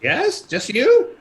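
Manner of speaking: high slow quavering crone; drawn-out question like a frail ferrywoman; old lady voice. Acted. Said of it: "this old lady says".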